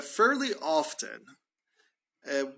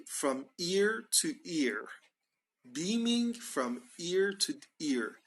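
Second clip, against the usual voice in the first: slow model phrase; 3 semitones higher, 4 dB quieter, slower than usual. Shown as a demonstration. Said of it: "when we say somebody is beaming".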